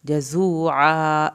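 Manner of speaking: slow, loud, drawn-out tajweed chant; Quran recitation. Shown as a demonstration. Teacher recites aloud for students, slow loud chant. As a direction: chant each word slowly for the class to follow. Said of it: "زا کو پڑھا ہم نے سختی سے".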